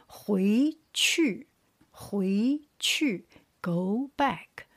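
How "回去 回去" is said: 回去 with 回 rising and 去 falling sharply; second tone, fourth tone. Shown as a demonstration. Teacher showing the tone pair, 回 rising, 去 falling. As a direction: lift 回, then drop 去. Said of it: "second tone with the fourth tone".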